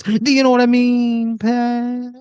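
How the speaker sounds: high, loud breathy croon; breathy talk-down. Acted. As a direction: purr it like a glam rock star. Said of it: "he goes".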